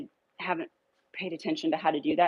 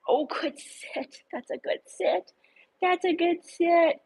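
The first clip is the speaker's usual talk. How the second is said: much higher, slow and drawn out; high praise voice; 11 semitones higher, slower than usual. Real, not acted. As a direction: reward the sit with sweet, stretched praise. Praising the dog's sit.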